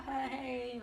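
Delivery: high-pitched playful "hey"; mock flirty hey; flirty greeting. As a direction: pitch it up like a casual flirty hello. Voicing their casual flirty greeting.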